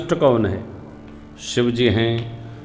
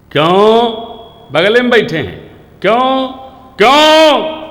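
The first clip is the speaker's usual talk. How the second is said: loud, rising shouted क्यों, higher each time; shouting; 8 semitones higher, 7 dB louder than usual. Retold or imitated. Quarrelling couple sitting together yell at each other. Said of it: "जोर से।".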